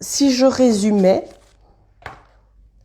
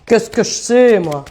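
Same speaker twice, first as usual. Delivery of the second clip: louder, playful put-on accent on "Qu'est-ce que je sais, moi"; 5 dB louder than usual. Tarot reader showing off her accent to live viewers, playful put-on accent. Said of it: "Vous l'entendez, mon accent?".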